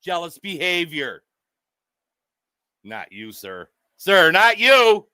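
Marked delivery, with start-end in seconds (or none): 2.8-3.7 soft aside. Pretend. Quietly reassuring the man he is roasting. 4.0-5.0 drawn out and louder, leaning on you; slow, louder reassurance. Pretend. Calling out to the man he is roasting.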